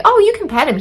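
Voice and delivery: high-pitched imitation of reassuring onlookers; mock reassurance. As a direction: chirp it high, mimicking dog lovers. Mimics people vouching for dog.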